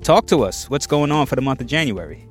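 ordinary delivery

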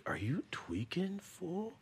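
breathy whisper, barely voiced; whispered quote. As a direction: hiss the question under your breath. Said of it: "I hear him like".

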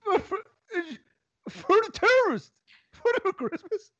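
mock Swedish babble. Mock Swedish gibberish rant.

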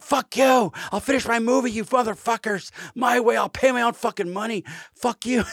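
high, loud defiant shouting; furious outburst. Retold or imitated. Cameron telling studio off.

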